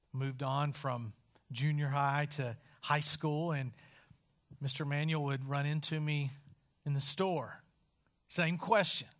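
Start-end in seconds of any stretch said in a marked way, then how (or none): none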